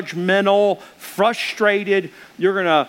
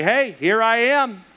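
higher, slow, showy self-announcing; self-important entrance; 5 semitones higher, slower than usual. Shown as a demonstration. Pastor showing the wrong greeting on entering a room, showy and self-focused. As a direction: announce yourself like the star of the room. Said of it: "don't say".

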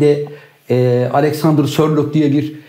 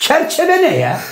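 much higher, slow put-on voice; mock incredulous voice; 12 semitones higher, slower than usual. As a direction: ham up the disbelief. Mocking disbelief continues.

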